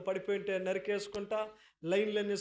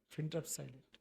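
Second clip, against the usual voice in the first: lower, soft and hushed; hushed answer; 5 semitones lower, 8 dB quieter than usual. Acted. Pastor naming the most frightening silence, hushed. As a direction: drop low and quiet for effect.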